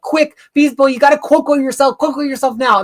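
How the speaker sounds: high, loud, urgent plea; agitated crowd. Acted. Agitated crowd begging Beast Boy to transform, urgent shouting. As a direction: cry out in mock panic. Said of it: "the crowd is getting agitated".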